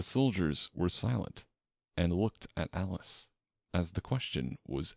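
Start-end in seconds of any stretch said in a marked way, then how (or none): none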